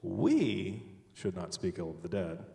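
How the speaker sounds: single out We, then drop away; stress on We. Shown as a demonstration. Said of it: "emphasize a different word each time".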